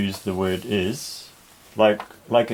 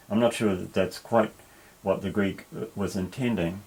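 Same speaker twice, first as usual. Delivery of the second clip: ordinary delivery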